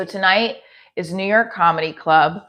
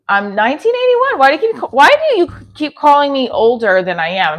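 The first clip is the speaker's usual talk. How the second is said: insisted much higher and louder, exasperated; indignant correction; 7 semitones higher, 4 dB louder than usual. Real, not acted. Streamer answers chat calling her older, indignant and loud. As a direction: set the chat straight, fed up.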